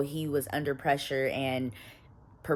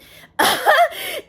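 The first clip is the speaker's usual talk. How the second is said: laugh; 10 dB louder than usual. Real, not acted. Laughs at her own joke.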